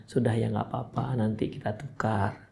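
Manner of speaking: low, very soft reassurance; gentle hushing. Retold or imitated. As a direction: imitate a husband quietly soothing his crying wife.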